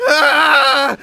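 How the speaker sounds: startled cry. Acted. Threatened, cries out.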